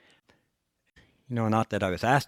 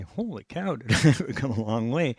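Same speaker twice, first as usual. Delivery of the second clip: high, laughing holy cow, then the rest louder; amazed exclamation; 4 semitones higher, 4 dB louder than usual. Retold or imitated. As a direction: marvel at how far he has come. Looking back in amazement.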